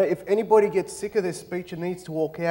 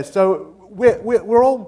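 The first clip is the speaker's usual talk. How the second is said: ordinary talk, nothing marked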